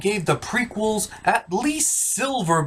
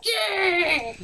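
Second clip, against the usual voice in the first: mock cheer; 12 semitones higher, 3 dB quieter than usual. Rebels cheering in parody.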